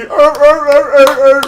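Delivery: loud, high imitated seal barking; circus seal barks. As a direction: bark like a trained seal begging for fish. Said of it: "well-trained circus seal".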